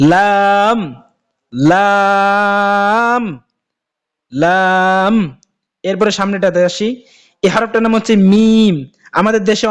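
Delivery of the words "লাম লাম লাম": লাম held long, tongue tip to palate; drawn-out Arabic letter lam. Shown as a demonstration. Teacher drills students on the letter, long drawn-out লাম. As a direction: stretch লাম slowly, tongue on the roof of the mouth. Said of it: "যেমন".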